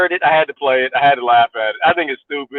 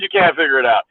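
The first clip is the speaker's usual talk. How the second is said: ordinary talk, nothing marked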